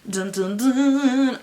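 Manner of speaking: rising hammed-up dun dun dun; dramatic sting. Reader closing the blurb with a mock dramatic sting. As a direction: sing a cheesy suspense cue, last note loudest.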